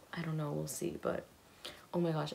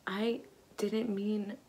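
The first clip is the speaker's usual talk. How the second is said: ordinary delivery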